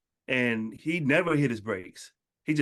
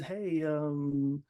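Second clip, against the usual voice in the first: shy nervous person; 4 semitones higher, slower than usual. Retold or imitated. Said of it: "be shy about it like I was".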